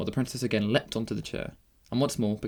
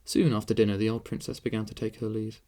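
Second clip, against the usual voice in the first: ordinary delivery, nothing marked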